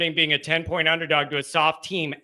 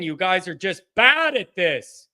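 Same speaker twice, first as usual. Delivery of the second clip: ordinary talk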